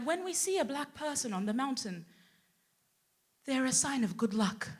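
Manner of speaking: softly voiced, earnest remark; hushed Swiss local. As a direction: say it gently, as a solemn confidence. Voices Swiss family member.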